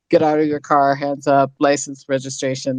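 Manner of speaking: a hint of a cop-show officer giving orders. Host quoting typical cop shows, lightly voiced cop. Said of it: "the same cop shows".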